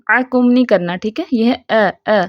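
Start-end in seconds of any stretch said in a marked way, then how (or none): none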